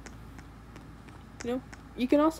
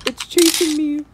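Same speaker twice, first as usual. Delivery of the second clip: scared horror game streamer; 4 semitones higher, 8 dB louder than usual. Real, not acted. Chased in game.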